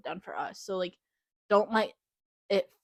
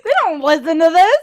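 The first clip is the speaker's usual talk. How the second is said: high, loud laughing reassurance; 10 semitones higher, 16 dB louder than usual. Real, not acted. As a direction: reassure her while laughing.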